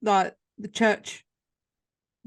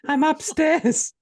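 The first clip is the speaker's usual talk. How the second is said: laughing reply; 7 semitones higher, 3 dB louder, slower than usual. Real, not acted.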